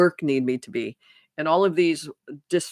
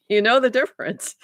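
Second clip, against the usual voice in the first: laughing; 8 semitones higher, 3 dB louder than usual. Real, not acted. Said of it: "And I'm laughing because".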